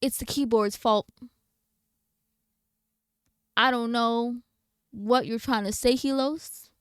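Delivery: ordinary talk, nothing marked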